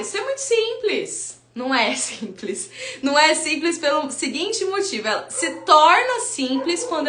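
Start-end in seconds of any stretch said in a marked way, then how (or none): 0.0-1.4 a skeptical parent. Retold or imitated. Voicing a student's objection.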